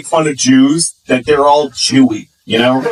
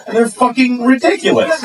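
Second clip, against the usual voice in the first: normal talking voice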